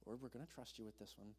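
soft, breathy resigned prayer; sighing prayer. Retold or imitated. Frustrated driver handing the damage to God, soft sighing prayer. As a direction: surrender it with a sigh.

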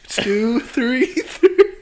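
laughing, high and slow; being tickled. Real, not acted. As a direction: squeal it out while being tickled. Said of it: "stop tickling me".

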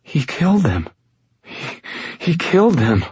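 high, stammering horror; rising panic. A narrator's reading. Injured girl remembers the killings, panic building. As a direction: start stunned and high, then stumble toward panic. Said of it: "building toward panic".

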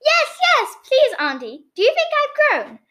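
put-on high-pitched, excited child voice; eager little boy. A narrator's reading. Boy offered a sweetie by his aunt, high eager voice. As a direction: answer eagerly like a small child.

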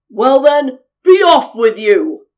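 loud, gruff troll dismissal; big grumpy troll. A narrator's reading. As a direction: send them off with a booming troll bark. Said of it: "said the troll".

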